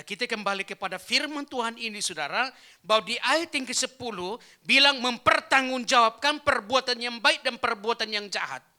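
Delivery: high, loud pulpit delivery; forceful preaching. Real, not acted. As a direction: drive the verse home loudly. Preaches the verse at full force.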